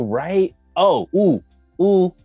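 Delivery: ordinary talk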